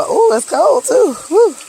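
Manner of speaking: high, loud startled squeal; cold-water shock. Real, not acted. Hiker stepping into cold water in wet Crocs, startled. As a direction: yelp at the sudden chill.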